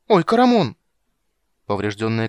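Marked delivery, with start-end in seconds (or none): 0.1-0.8 high loud startled shout; alarmed cry. Acted. Friend's knee suddenly gives way. (испуганный вскрик)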